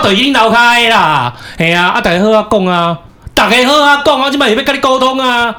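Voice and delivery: taunting the neighbour with fake politeness; sarcastic yell. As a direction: bellow "let's talk nicely" with menace. Mock sarcastic shouting at door.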